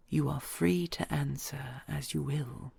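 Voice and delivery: hushed, intimate whisper to a loved one; tender whisper. Acted. Dragon whispering vows to her treasure.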